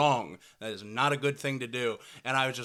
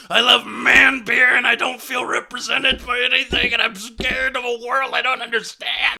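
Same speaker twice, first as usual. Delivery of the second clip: loud, shouting dumb bro caricature; a hammed-up man beer guy, proudly yelling; mock macho bro; 6 semitones higher, 10 dB louder than usual. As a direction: bellow it like a beer-swilling meathead. Mocking macho beer guy rant.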